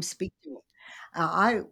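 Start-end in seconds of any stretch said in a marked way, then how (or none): 0.0-0.6 whisper. Retold or imitated. Recounting hearing God.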